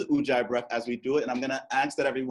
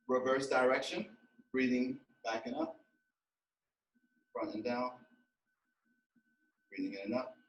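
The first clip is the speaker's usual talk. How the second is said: soft, spaced-out movement cues; guided breathing exercise; 3 semitones higher, 5 dB quieter than usual. Real, not acted. Teacher leading a class through breathing stretches, guided breathing exercise. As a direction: lead them gently breath by breath, with long pauses.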